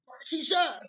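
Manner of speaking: calling a name up loud, mock yell; imitated yell. Sister yelling down the steps, mock yell. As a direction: holler the name down the stairs. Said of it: "She yelled us down the steps".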